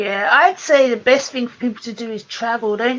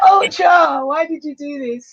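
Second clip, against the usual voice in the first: mock crying; 4 semitones higher than usual. Mock distress over disturbing images.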